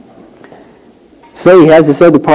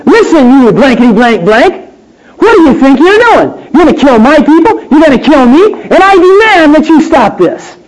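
indignant demand; 9 semitones higher than usual. Pretend. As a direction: tell him off with indignation. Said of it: "With an indignant spirit".

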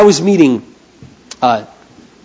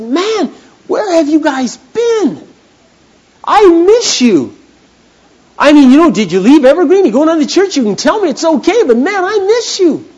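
effusive greeting; 11 semitones higher, 3 dB louder than usual. Retold or imitated. Pastor hugging a lost couple, gushing welcome. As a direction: throw your arms around them with it.

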